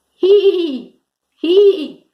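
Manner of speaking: high, drawn-out whinny; whinny like a horse; horse neigh. Shown as a demonstration. Teacher giving kids a clue for the horse, neighing. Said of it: "The horse says he".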